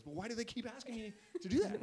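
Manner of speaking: high, loud mock exasperation; baffled complaint. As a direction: plead in bewildered protest, hammed up. Acts out coach's baffled complaint.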